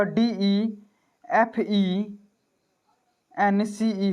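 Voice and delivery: letter by letter, very slow: डीईएफ़ईएनसीई; slow dictation spelling. Shown as a demonstration. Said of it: "लेकिन American English में".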